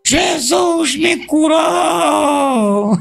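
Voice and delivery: high, long-drawn cry of healing; retold joyful shout. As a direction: imitate her crying out, stretching every word. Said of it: "começou a gritar lá no ponto". (grito imitado, agudo e prolongado)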